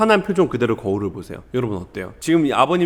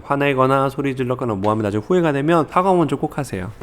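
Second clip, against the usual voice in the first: usual speaking voice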